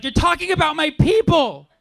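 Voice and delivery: high, loud indignant heckler; hammed-up offended shout; mock outraged shout. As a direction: shout it like she's deeply offended. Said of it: "She's like".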